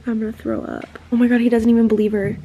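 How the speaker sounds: ordinary delivery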